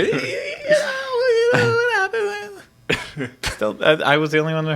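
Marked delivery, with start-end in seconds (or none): 0.0-3.3 squeaky voice shooting way up high; slow, stretched high-pitched squeal; voice cracking high. Retold or imitated. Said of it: "went up four octaves".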